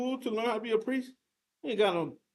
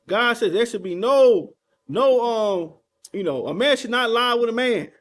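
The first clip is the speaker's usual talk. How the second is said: loud, impassioned denunciation from the pulpit; fiery sermon, voice raised and driving; full-force preaching; 2 semitones higher, 7 dB louder than usual. Real, not acted. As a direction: preach it loud, rousing the people to wake up.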